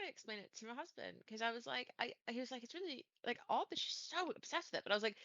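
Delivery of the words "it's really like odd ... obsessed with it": mock puzzlement. Retells husband's puzzled remark.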